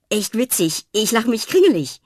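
loud, high sarcastic clown; Fred mocks her fake laugh. A narrator's reading. (sarkastisch und laut)

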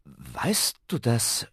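slow, halting question; hesitant, broken-up stammer. A narrator's reading. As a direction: falter as if caught out. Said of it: "stammelte Ben".

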